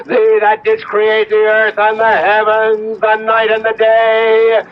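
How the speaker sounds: incantation. Acted. Simon invokes the Bornless One before Reb Meyer, solemn incantation building. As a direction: chant it like a spell, building.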